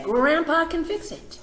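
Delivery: put-on high, bright cry of a boy sure of Grandpa; boy pipes up high and loud; eager child. Boy insisting to his mother, eager and high.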